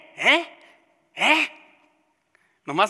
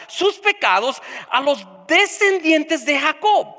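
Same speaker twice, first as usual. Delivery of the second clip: preaching at full force; 7 semitones higher, 6 dB louder than usual. Acted. Pastor exhorting the congregation, preaching at full force. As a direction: pound out each phrase like a revival preacher.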